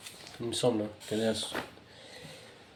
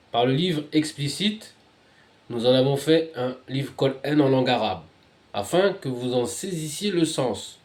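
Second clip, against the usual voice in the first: higher, emphatic reading aloud; declaimed scripture reading; 3 semitones higher, 5 dB louder than usual. Real, not acted. Reader proclaiming the Arabic book to listeners, declaimed scripture. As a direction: proclaim the verse phrase by phrase.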